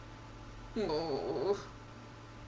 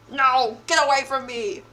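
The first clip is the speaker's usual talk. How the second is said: louder, higher push-back; loud protest; 5 semitones higher, 6 dB louder than usual. Real, not acted. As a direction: shove him off with your voice. Fending off attacker.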